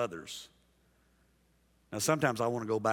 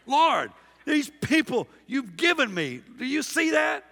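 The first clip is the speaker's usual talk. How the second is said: much higher, louder, hammed-up exasperation at God; exasperated mock shout; 8 dB louder than usual. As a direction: cry out to the Lord in comic despair. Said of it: "I've prayed that prayer as a pastor".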